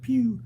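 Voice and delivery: high single zap; one quick high laser zap; laser pew. Retold or imitated. Streamer reading another viewer's pew, high zap. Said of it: "And so does Elizabeth".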